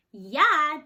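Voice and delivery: loud, high scoffing yeah; snorting. A narrator's reading. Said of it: "Jaya snorted".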